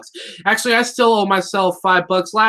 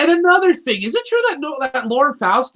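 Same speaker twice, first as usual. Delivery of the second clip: rising mock rant; 7 semitones higher than usual. Building up to a rant.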